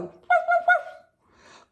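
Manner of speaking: high yappy little woofs; little dog woof. Acted. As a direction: yip like a tiny dog. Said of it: "Are we a little dog?".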